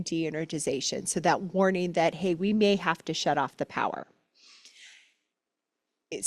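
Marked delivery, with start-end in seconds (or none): none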